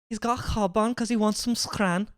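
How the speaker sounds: high-pitched Scouse imitation; Scouse accent. Echoing a Scouse example phrase.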